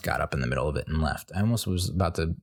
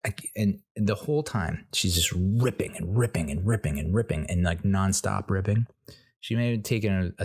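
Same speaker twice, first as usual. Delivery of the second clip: ordinary talk, nothing marked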